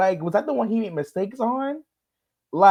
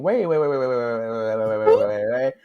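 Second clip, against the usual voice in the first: rapid-fire run of waits, sliding lower; halting disbelief; 7 semitones lower than usual. Retold or imitated. Viewer stopping the judge's Halloween claim, mock halting disbelief. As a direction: tumble the waits out in one descending string.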